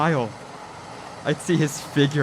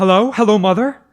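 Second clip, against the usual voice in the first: higher and louder, pleading into the phone; reaching for his mother's voice; eager desperate hello; 5 semitones higher, 6 dB louder than usual. Acted.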